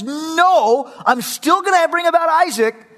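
high, loud firm no; firm refusal. Acted. God firmly refusing Abraham, high and loud. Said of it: "And God says".

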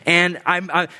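ordinary delivery

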